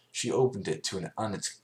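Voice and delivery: ordinary delivery, nothing marked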